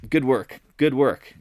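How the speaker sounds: mock pat on your own back; self-congratulation. Trader praising himself for his vix call, self-congratulatory. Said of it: "I'm patting myself on the back".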